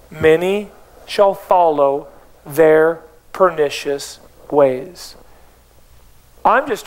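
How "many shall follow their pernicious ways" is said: slow, low, drawn-out reread, relishing "pernicious"; low, dragged-out savoring of the verse. Shown as a demonstration. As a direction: linger on every word with relish. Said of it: "And I love verse two".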